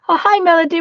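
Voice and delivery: ordinary talk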